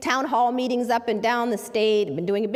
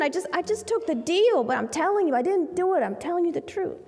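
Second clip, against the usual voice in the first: higher, pleading voice of a desperate client; mild pleading client; 6 semitones higher than usual. Retold or imitated. Client pleading innocence to his lawyer, voice raised. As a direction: beg the lawyer to believe you.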